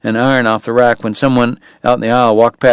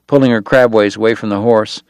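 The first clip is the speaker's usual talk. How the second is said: plain reading voice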